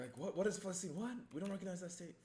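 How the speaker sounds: high-pitched mocking impersonation. Mimicking someone who refuses to recognize Palestine, high mock voice.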